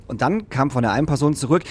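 ordinary talk

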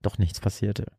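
usual speaking voice

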